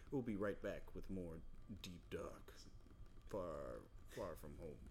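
low, hushed spooky teaser read; mock show intro. Podcast hosts joking a spooky segment intro, low hushed. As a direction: creep the title out like a horror show.

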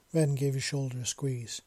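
ordinary delivery

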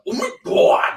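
high breathy cry; Nick Kroll impression. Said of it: "Nick Kroll doing the".

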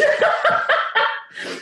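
airy high laugh; breathy laugh. Real, not acted. Guest laughing at the thought of trouble at church.

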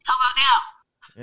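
tinny phone line; like talking through a tin can and rope. Real, not acted. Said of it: "it's like a tin can and a rope".

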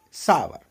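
सावर clearly in two syllables; correct sour. Shown as a demonstration. Teacher models सावर for students. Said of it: "S A W E R".

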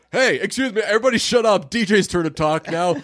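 hammed-up "look at me" announcement; mock self-important. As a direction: play someone hogging the room's attention. Friend's mock attention-seeking quote.

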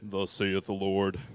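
put-on booming old-time prophet voice, half laughing; mock biblical prophet. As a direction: proclaim it like an Old Testament prophet. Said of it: "You guys say it like a prophet".

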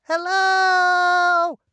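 high, whiny little servant calling; a reedy, pleading little voice calling out. A narrator's reading. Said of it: "I can just hear his whiny voice".

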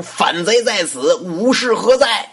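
slow, high, drawn-out battle cry; loud shout. A narrator's reading. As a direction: call the guards in with a full-throated shout. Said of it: "王允大喊一声".

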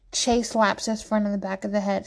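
usual speaking voice

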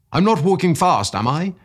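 high, loud, surprised question; excited cry. A narrator's reading. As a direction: exclaim it, keyed up and oblivious. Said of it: "cried Gaburni".

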